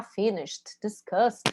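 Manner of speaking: Finished and discussed each end in a t sound, no E. Shown as a demonstration. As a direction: close Finished and discussed on a crisp t. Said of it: "tem um som de T".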